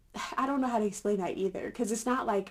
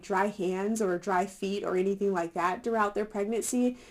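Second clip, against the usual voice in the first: usual speaking voice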